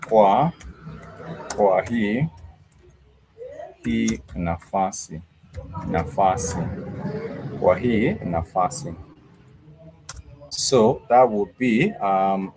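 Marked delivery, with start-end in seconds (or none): 0.1-8.9 kwa, kwa hii, hii nafasi built up word by word; teacher building kwa hii nafasi slowly for learners; slow word drill. Shown as a demonstration. Slow step by step. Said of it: "hii nafasi (x0.6)".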